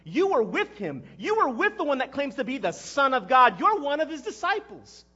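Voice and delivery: loud, high accusing shout, building; screamed accusation. Acted. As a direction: scream it as a public denunciation. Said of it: "and she's screaming".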